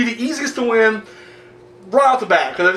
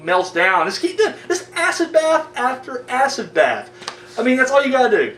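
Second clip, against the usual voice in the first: ordinary talk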